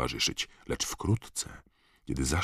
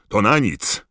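loud, higher cry of frustration; shout; 6 semitones higher, 6 dB louder than usual. A narrator's reading. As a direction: yell that it's useless. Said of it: "krzyknął inny czarodziej".

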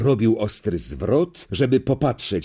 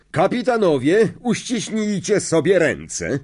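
a lightly voiced referee, higher, unhurried command; referee's order; 7 semitones higher than usual. Madam Hooch to the captains, firm call. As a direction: call the captains together firmly. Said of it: "powiedziała pani Hooch".

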